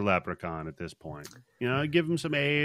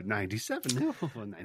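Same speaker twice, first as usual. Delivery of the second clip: lightly imitated leprechaun count with a soft chuckle; impishly happy; 3 semitones lower, 4 dB quieter, much faster than usual. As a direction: count the gold with a touch of impish glee. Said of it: "so impishly just happy".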